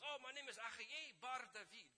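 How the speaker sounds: loud, high, hearty introduction; imitating a boisterous tour guide; exuberant guide. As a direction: announce your name with gusto. Acting guide introducing himself proudly.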